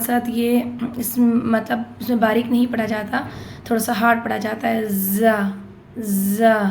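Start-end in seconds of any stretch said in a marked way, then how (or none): 4.9-6.6 زا with a thick buzzing z; زا buzzed and said a little hard. Shown as a demonstration. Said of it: "اس طریقے سے ادا ہوگا". (زا تھوڑا ہارڈ اور بزنگ آواز میں)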